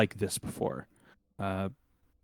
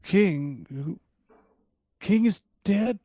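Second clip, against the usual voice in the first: put-on high, startled old man's questions; 10 semitones higher, 6 dB louder, slower than usual. Forgetful elder hears of the king's funeral, high bewildered old voice. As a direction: react loudly as a bewildered elder, pitch leaping up. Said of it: "is so old. He's so old".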